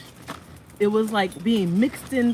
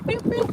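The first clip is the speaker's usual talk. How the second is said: beep beep. Acted. Playful beep sounds at baby.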